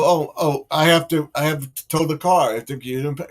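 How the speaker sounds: high, loud and fast, flustered excuses; mock tow driver. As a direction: rattle off excuses like a nervous driver. Listener imagining the tow driver.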